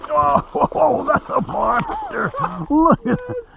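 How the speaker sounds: high, loud, delighted; excited exclaiming. Real, not acted. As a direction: shout in delight at the catch. Excited at big catch.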